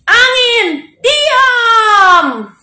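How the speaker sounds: high, loud drawn-out shout; ringing cry of authority; commanding. Acted. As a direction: order the wind to be still. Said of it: "Tuhan Yesus bilang apa?".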